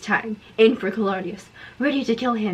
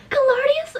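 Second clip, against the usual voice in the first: mock alarm; 14 semitones higher than usual. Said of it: "I asked in alarm".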